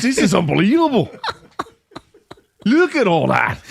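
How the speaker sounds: hammed-up Brit gawping at huge portions; mock British amazement. Mocking a British fan amazed at American portions, hammed-up high voice.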